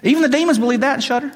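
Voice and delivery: put-on very high, rapid Southern US accent. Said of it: "he has a southern accent".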